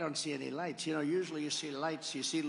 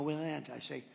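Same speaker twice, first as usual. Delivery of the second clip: usual speaking voice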